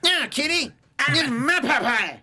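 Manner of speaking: high, bratty cartoon impression; Eric Cartman. Friend topping the cartoon impression, Cartman voice. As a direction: stretch it out like a cartoon kid. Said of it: "Kinda sounds like a Eric Cartman".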